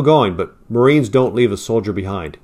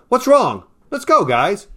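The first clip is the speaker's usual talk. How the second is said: high, raised exasperated yell; 9 semitones higher, slower than usual. Acted. Pilot yelling at Marines who won't move, exasperated yell. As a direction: throw it out in frustration. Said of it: "the pilot yelled".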